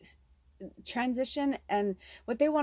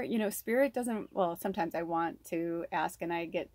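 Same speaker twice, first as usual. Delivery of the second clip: usual speaking voice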